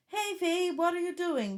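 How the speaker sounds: phone lady. Retold or imitated. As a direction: read a text out as the car's assistant. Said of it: "the lady on the phone".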